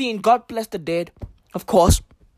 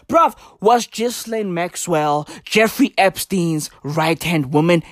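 ordinary delivery

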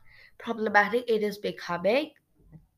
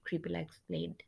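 ordinary delivery

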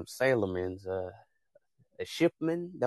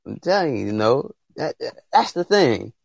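building into a louder preacher's cadence; preaching; 7 dB louder than usual. Real, not acted. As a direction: preach it like a pastor warming up the congregation.

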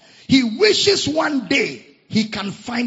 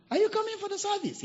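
high chatty church lady; 5 dB quieter, faster than usual. Retold or imitated. Church member's voice.

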